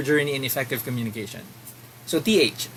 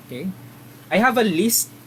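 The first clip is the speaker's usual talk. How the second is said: usual speaking voice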